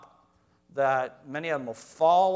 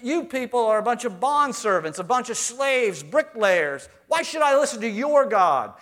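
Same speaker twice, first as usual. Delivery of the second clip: loud, higher contemptuous taunt; scornful Pharaoh; 5 semitones higher, 6 dB louder than usual. Retold or imitated. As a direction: look down on the slaves and mock. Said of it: "The world says that".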